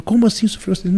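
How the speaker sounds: high, alarmed question, imitating her fear; retold panic. Secretary asks about the accident, high panicked imitation. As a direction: play the secretary losing her calm. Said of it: "Daí ela entra em desespero".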